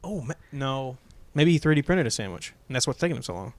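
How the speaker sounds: ordinary delivery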